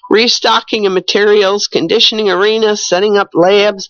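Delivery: ordinary delivery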